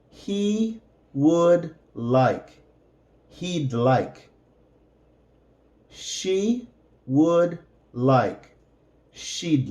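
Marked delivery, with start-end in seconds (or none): 0.1-2.6 "He would like" said slowly, each word apart; slow, careful teacher read; slow separated words. Shown as a demonstration. 5.9-8.6 "She would like" said slowly, each word apart; careful classroom model; slow separated words. Shown as a demonstration.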